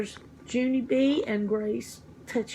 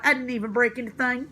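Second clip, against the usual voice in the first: ordinary delivery, nothing marked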